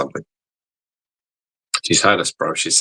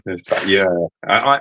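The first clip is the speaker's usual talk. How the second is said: ordinary delivery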